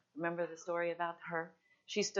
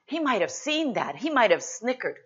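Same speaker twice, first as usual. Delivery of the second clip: scornful onlooker; 6 semitones higher, 10 dB louder than usual. Acted. Imagined onlooker's scorn, built up.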